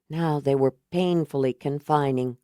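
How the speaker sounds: usual speaking voice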